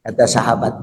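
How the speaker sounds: usual speaking voice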